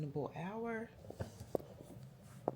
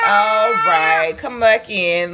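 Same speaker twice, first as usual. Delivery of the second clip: laughing call; 20 dB louder than usual. Real, not acted. Calling baby back inside.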